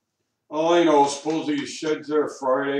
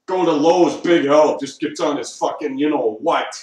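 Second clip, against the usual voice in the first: loud griping; 9 dB louder than usual. Real, not acted. Angry rant about store.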